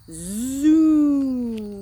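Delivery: loud, high, drawn-out zoom; lawnmower zoom. Shown as a demonstration. Showing children a lawnmower, loud long zoom. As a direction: make a big steady mower noise. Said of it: "It goes like this".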